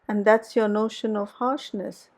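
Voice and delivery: ordinary delivery, nothing marked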